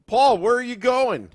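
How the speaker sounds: lightly done Boston voice; Boston accent. Retold or imitated. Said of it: "had the best Boston accent".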